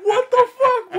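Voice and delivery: high, loud cry of mock disbelief through laughter; retold outraged protest. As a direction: squawk it at mom, half laughing. Retold protest to mom amid laughter.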